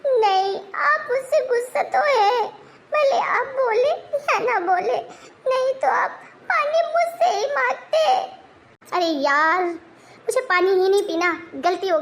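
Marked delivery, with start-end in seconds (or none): 0.9-8.1 teary accusation. Acted. Wife accusing husband through tears of being angry, teary accusation.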